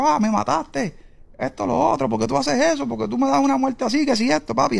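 high, agitated complaint at ordinary volume; retold protest. Dying man demanding answers from God, high-pitched retold protest. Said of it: "empiezo a reclamarle a Dios".

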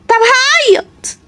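high, very slow whine; high, drawn-out kid whine; whiny child. Acted. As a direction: drag it out like a kid about to cry. Child threatening to cry.

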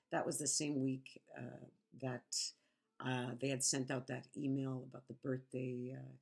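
normal talking voice

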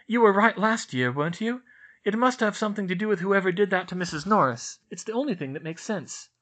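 lightly voiced earnest schoolgirl; Hermione reassuring. A narrator's reading.

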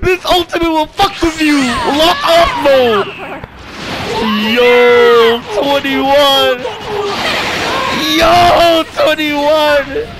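high, loud hype yelling; blown-away gamer outburst; ecstatic shouting. Real, not acted. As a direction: yell it out in amazed delight.

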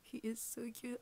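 ordinary delivery

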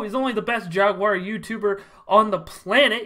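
usual speaking voice

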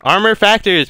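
excited yell. Real, not acted. Hyped commentary mid-fight.

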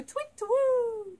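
high falling owl hoot; high twit-woo owl call. Shown as a demonstration. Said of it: "I like an owl noise".

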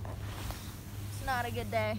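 soft mock-sad news; mock gloomy. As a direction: announce it like a sad bulletin.

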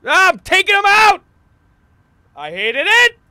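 loud, high panicked shouting; scared horror game streamer. Real, not acted. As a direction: yell in real fright.

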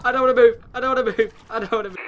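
high, loud panic easing off; panicked pleading. Real, not acted. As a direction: repeat it in a fright, loud then fading. Refuses to move after screaming.

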